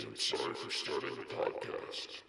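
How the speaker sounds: unvoiced, hushed apology; whispered sorry. Shown as a demonstration. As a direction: breathe it out guiltily. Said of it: "and say".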